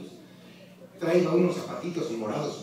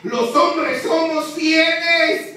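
slow, much higher, louder; emcee shout; 9 semitones higher, 7 dB louder, much slower than usual. Real, not acted. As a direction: bellow it out for a response. Emcee shouts statement to crowd.

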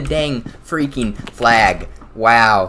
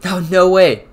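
excited shout; 8 semitones higher, 7 dB louder than usual. Real, not acted. Lucky skin unlock.

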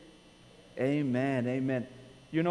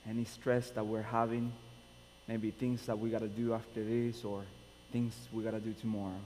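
soft, lower prayer voice; opening prayer; 5 semitones lower, 7 dB quieter than usual. Real, not acted. Pastor leading the congregation in prayer, soft and low. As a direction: pray quietly to the Father.

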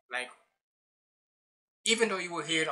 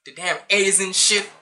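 ordinary talk, nothing marked

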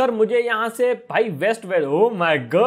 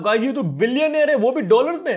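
amazed; 6 semitones higher than usual. Acted. Discovers boss is a billionaire.

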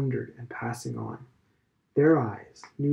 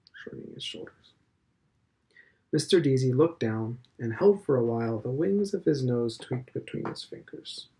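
ordinary delivery, nothing marked

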